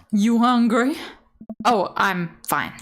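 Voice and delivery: ordinary talk, nothing marked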